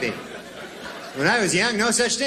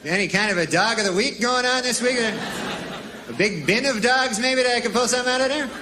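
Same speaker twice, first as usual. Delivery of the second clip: haggling customer; 7 semitones higher than usual. Retold or imitated. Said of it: "But I was going".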